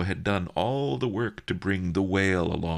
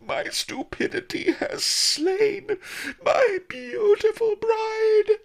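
much higher, slow crying wail; hammed-up wailing; 6 dB louder, slower than usual. Boy fakes grief over his bride, high slow crying. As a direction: overplay the heartbreak in a long wail. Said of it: "I shrieked".

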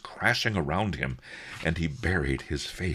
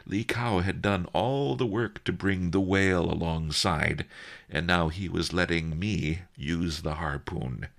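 ordinary delivery

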